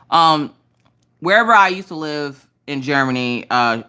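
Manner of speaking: ordinary talk, nothing marked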